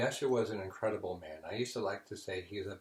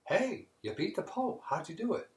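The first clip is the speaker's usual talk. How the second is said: high, eager and louder; clergy rushing up excitedly to the pagan; excited question; 10 semitones higher, 4 dB louder than usual. Acted. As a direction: burst in thrilled to know.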